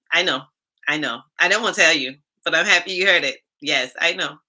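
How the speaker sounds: ordinary delivery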